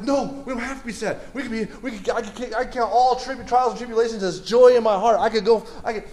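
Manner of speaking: rally a gloomy friend, bursting with joy; all excited. Acted. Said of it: "I'm all excited".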